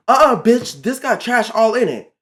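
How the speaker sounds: loud, high imitation of a rude coworker; rude as fuck. Coworker spotting trash in the can, imitated rude scolding. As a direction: hit it loud with big attitude. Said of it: "she said it rude as fuck".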